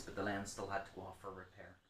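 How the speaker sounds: ordinary talk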